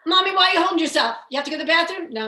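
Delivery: high, loud, quick mock interrogation; hammed-up grandmother pestering a child, laughter creeping in; obsessed grandma. Grandma obsessing over a child holding herself, loud mock fussing. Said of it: "My grandma was always obsessed".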